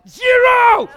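mock shout. Answering the tip screen.

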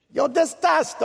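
high-pitched playful Italian flourish; mock Italian accent. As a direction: toss it out like a hammed-up Italian. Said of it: "I sounded like an Italian".

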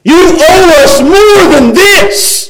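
high, slow, aggrieved protest; drawn-out high-pitched indignation; indignant demand. Acted. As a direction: hurl the complaint up at God, word by word. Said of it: "they look up at God and say".

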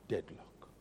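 "Deadlock" breathed in a whisper; hushed whispered echo of "Deadlock". Shown as a demonstration. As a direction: whisper "Deadlock" to let it sink in. Repeats deadlock.

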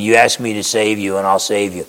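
Jesus speaking gently to the Samaritan woman, tender; gentle. Retold or imitated. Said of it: "very gentle with her".

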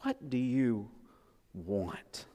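soft, low, pause before "want"; stress on WANT. Shown as a demonstration. Pastor repeating where the emphasis goes, stress on WANT. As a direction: hold back, then let "want" drop quietly by itself. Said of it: "that's where the emphasis goes".

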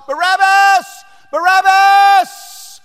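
very high, very loud drawn-out call; crowd calling out. Acted. Crowd calling the prisoner's name, loud drawn-out call. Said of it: "He hears his name called out by the crowd".

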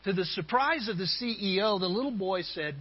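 normal talking voice

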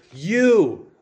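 "You" drawn out and punched, standing alone; stress on "You". Shown as a demonstration. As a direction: hit "You" as if in all caps. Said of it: "He's emphasizing that word".

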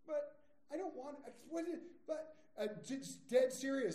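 praying at night, a hammed-up whine; whining prayer. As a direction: whine to God like a sulking child. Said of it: "So I'm whining on Thursday night".

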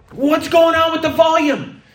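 very high, loud mock complaint; exasperated outcry. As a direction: cry out like a frustrated driver. Acts driver annoyed at silent GPS.

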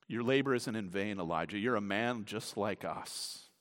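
low, soft voice of comfort; gentle reassurance. Acted. God softly comforting the worn-out prophet Elijah, low and gentle. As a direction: settle him down quietly.

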